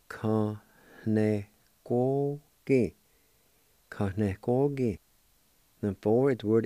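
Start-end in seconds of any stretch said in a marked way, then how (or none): 0.1-2.9 "Ka ne ko ki" drawn out slowly, each syllable separated; slow syllable-by-syllable Cherokee. Shown as a demonstration. Teacher modelling "Ka ne ko ki" slowly for students. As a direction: sound out "Ka ne ko ki" slowly for learners. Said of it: "it's pronounced like this".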